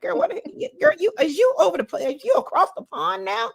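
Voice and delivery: high, puzzled mock questioning; high-pitched mock disbelief; acted audience reaction. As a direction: act out a nosy, surprised listener. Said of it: "I know you're like".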